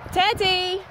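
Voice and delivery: high loud call across a field; calling the dog. Real, not acted. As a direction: shout for the dog to come back.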